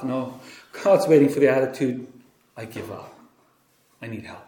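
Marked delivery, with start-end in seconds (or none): 2.6-4.5 low, near-whispered plea; hushed surrender. Retold or imitated. The believer surrendering to God, hushed plea. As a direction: give up softly and ask for help.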